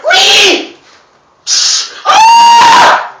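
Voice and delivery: high, loud playful whoop into a scream; hammed-up wee and yelp; mock whoop and scream. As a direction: whoop then shriek as the rock flies. Rock-throwing chase, acted cries.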